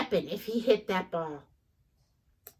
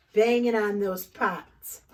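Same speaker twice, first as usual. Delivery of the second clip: usual speaking voice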